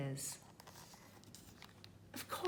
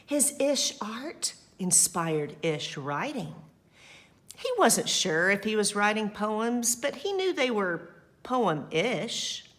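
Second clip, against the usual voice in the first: lively, louder read-aloud voice; story narration; 2 semitones higher, 4 dB louder than usual. A narrator's reading. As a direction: read it to the children with energy.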